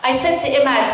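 usual speaking voice

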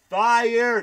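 high, loud yell of fire; imitated shout. Comedian mimicking a moviegoer shouting during a sad scene, loud yell. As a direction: bellow it like the man in the dark cinema. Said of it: "He's like".

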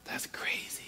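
hushed whisper of awe; whispered aside. Real, not acted. Preacher to congregation, awed whisper aside. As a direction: drop to a breathy whisper.

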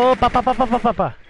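rapid high "pá pá pá" gunfire noises; pew-pew mouth gunfire in the heat of play; imitated gunshots. Real, not acted. Mimicking gunfire during a firefight.

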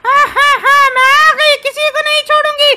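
high, loud cackling villainess; menacing ghost lady. Acted. Ghost lady announces she has come for them, menacing shriek.

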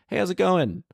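high, chirpy mock hello; mock cheery greeting. Man greeting fighting giants, high cheery mock hello. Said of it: "just like".

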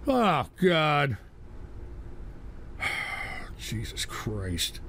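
low, soft, deflated; drained, sinking into a low murmur; weary despair. Real, not acted. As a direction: sigh it out with long pauses. Worn down after outburst.

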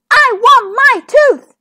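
high, loud, drawn-out demand; crying out. A narrator's reading. Little princess whose tooth has disappeared, crying out. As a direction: wail it word by word. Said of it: "cried the little princess".

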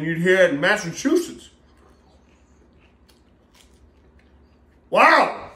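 speaking with food in mouth; muffled by a mouthful; chewing while talking. Real, not acted. Seen 1: Bites at -1.0, mouth closed and working at start of words.

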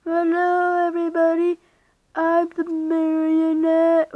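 high, loud drawn-out greeting; marionette character. Acted. Marionette greeting everybody, high loud character voice. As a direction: introduce yourself high and loud, stretching the words. Figure introducing itself in skit.

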